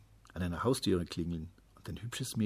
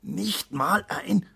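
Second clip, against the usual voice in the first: high, slow, louder disbelief; disbelieving father, voice raised; incredulous restart; 10 semitones higher, 5 dB louder, much slower than usual. A narrator's reading. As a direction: draw it out in dismay and leave it hanging. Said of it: "begann sein Vater noch einmal".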